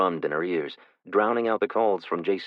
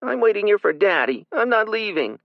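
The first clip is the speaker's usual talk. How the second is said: put-on tearful child; 6 dB louder than usual. Crying child refuses.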